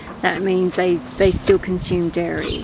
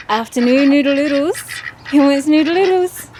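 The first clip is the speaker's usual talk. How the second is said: higher, louder coaxing sing-song; sing-song call to ducks; 7 semitones higher, 4 dB louder than usual. Real, not acted. Owner calling her ducks to noodles in a bright sing-song. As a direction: call the ducks over like a treat is coming.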